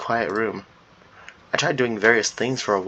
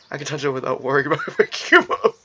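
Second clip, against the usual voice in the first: breaking into a laugh mid-line; laughing while reading. Real, not acted. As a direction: read it while cracking up.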